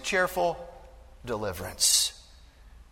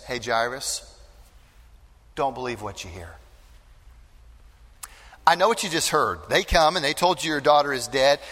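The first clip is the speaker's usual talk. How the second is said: gentle reassurance; 3 semitones lower than usual. Acted. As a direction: speak gently to a frightened father. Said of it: "in essence said this".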